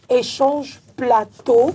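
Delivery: very slow, syllable by syllable; sounding out words. Shown as a demonstration. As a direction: stretch each syllable out slowly. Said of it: "qu'il faut qu'elle aille lentement".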